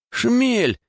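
high-pitched cry of alarm; испуганный крик. A narrator's reading. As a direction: yell it out, voice leaping up sharply. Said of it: "крикнул Егор". (испуганный вскрик)

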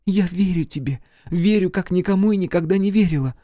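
high, fervent heroine's voice; passionate avowal. A narrator's reading. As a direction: avow it high, beside yourself with desire. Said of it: "не помня себя от вожделения, прошептала". (страстное признание высоким голосом)

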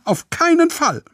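viel höher, schrilles Verbot; entschiedenes Nein. Acted. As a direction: kategorisch ablehnen. Mama verbietet die Reise. (Mama verbietet empört, dass Jojo mitfährt)

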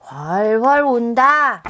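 slow, loud drawn-out call. Acted. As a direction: boom it out slowly. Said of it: "할머니도 큰 소리로 따라했어요".

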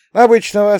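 very high, loud youthful answer; matter-of-fact boy. A narrator's reading. Boy explaining crickets to baffled grandpa, high matter-of-fact. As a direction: toss it back high, as if obvious.

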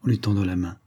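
usual speaking voice

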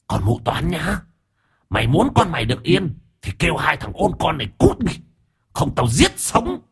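put-on a vengeful ghost woman hissing threats at the old woman; menacing ghost. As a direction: threaten like a spiteful female ghost. Female ghost threatening the family.

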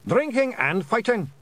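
high, rowdy clan cheer; high-pitched eager shout; shouted chorus. A narrator's reading. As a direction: yell it back together. Blue men shout back answers.

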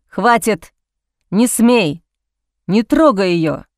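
ordinary delivery, nothing marked

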